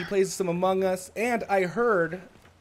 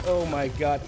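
usual speaking voice

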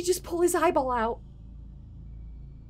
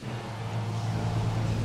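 wheezing silent laugh; helpless airy laughter; breathless unvoiced laugh; 6 dB quieter than usual. Real, not acted. Boss fight chaos.

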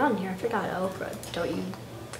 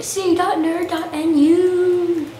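drawn-out, loud announcement; mock ad call; loud slow plug; 8 semitones higher, 10 dB louder, much slower than usual. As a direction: announce the server name slowly. Plugging a server name.

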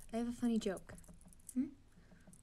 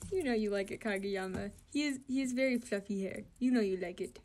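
usual speaking voice

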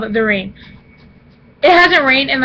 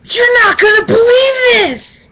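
high, loud burst of excitement; can't hold in the big news; excited shout; 9 semitones higher, 4 dB louder, much slower than usual. Real, not acted. Vlogger bursting to tell viewers the news, excited shout.

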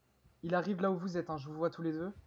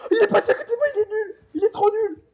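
much higher, louder gleeful gloating; gleeful taunting; 10 semitones higher, 9 dB louder than usual. Real, not acted. Mocking the killer gleefully.

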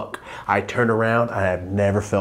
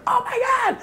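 put-on much higher, excited kid squeal; starstruck squeal. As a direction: squeal like a fan meeting his idol. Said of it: "my little brother, like".

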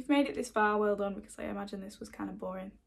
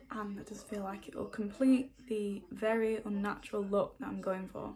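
ordinary talk

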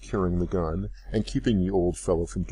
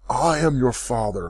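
louder, higher outburst; cried out; 5 semitones higher, 5 dB louder than usual. A narrator's reading. Father reveals himself to his daughter, crying out. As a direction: burst out with it, unable to hold back. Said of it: "cried he".

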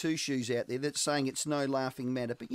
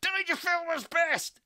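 high, drawn-out gushing call of Rowie's name; hammed-up squeal for Dangerfield; mock excited yell; 11 semitones higher, 4 dB louder than usual. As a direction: shout it like an overexcited fan.

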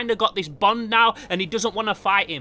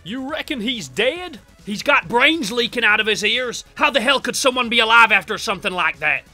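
hammed-up Southern family villain; 3 semitones higher, 6 dB louder than usual. Voices the family characters.

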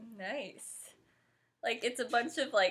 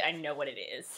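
matter-of-fact admission; 7 semitones lower, 4 dB quieter than usual. Real, not acted. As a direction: lower and softer, stress on I. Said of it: "Well, actually".

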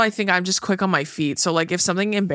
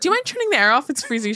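whiny customer; 7 semitones higher, faster than usual. Retold or imitated. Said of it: "Where she's like".

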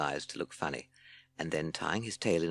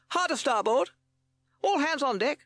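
high, loud shouted command; Captain Beaky shouting; 7 dB louder than usual. A narrator's reading. Captain to his crew, shouting orders. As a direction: call out orders at high pitch. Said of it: "he shouted".

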